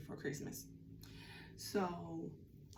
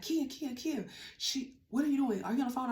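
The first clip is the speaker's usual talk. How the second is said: higher, louder urgent call of her name; 6 semitones higher, 4 dB louder than usual. Retold or imitated. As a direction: grab her attention fast, a bit alarmed. Sister calling urgently about news.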